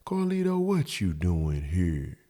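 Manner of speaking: hushed, slow mock-sweet woman; put-on innocent soft voice. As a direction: ask it softly, dragged out and innocent. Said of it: "talk softly".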